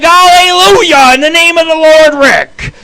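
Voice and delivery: high, loud mock hallelujah; exultant prayer. Comic retelling of thanks for a dry joint, exultant prayer. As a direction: praise heaven like a revival preacher. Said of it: "I'm, like, fucking saying my prayers".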